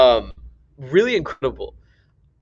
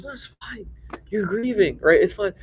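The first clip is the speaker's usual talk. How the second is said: gentle reassurance; 6 semitones higher, 4 dB quieter than usual. Retold or imitated. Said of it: "And he goes".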